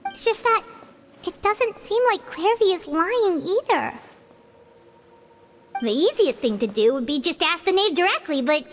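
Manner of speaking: usual speaking voice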